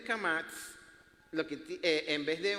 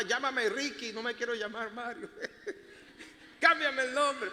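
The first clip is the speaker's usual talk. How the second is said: laughing mock plea; 6 semitones higher than usual. Child pleading with his father.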